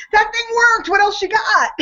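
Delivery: high, eager imitation of a thrilled friend; excited Bert. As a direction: pitch it way up, delighted. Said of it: "he said to me".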